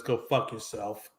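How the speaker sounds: a hint of a contemptuous Rob Manfred; dismissive brush-off. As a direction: mutter it like a cold boss waving him off. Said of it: "basically response to that was".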